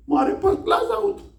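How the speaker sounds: high, whiny wail of a sulking student; whining crying boy. Retold or imitated. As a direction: wail it like a sobbing schoolboy. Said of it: "એ પણ રડે". (રડતા છોકરાનો ઊંચો રડમસ અવાજ)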